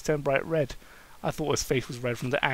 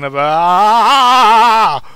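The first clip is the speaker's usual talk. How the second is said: slow, loud startled yell; 6 semitones higher, 18 dB louder than usual. Acted. Daniel yelling at Kane in surprise, loud startled yell. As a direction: cry out in shock. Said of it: "suddenly yelled".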